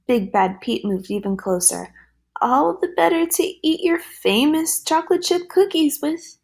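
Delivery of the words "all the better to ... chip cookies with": wolf drops the act with a high triumphant shout. Acted. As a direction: yell it high and gleeful, pitch up. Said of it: "he shouted".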